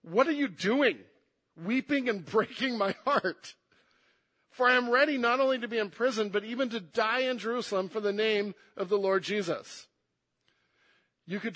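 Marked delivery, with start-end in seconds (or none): 0.0-9.9 louder, higher pleading protest, quicker on "For I am ready"; emotional answer. Acted. Paul answering weeping friends, emotional and insistent. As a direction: let the heartbreak push the voice up. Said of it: "there's more emotion to this answer".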